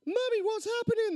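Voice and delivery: put-on high-pitched frightened child voice; crying-out kid. A narrator's reading. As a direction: cry out to Mummy like a small boy. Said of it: "the kid cried".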